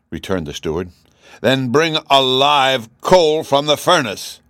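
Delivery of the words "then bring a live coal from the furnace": loud, high, drawn-out royal command; irate monarch giving orders. A narrator's reading. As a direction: order it forcefully and slowly. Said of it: "commanded the king".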